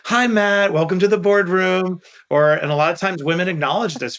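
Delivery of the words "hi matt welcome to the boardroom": mock welcome. Mock imagined welcome at boardroom.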